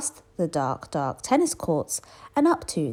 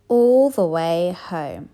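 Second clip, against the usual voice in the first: slow, louder emphatic close; drawn-out ending; 5 dB louder, much slower than usual. A narrator's reading. Narrator draws out the journey home, slowly. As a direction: stretch each word out. Story winding down.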